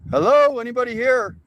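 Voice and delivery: loud, high call into the distance; shouting hello. Real, not acted. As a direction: call out to anyone on the property. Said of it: "He just screams hello".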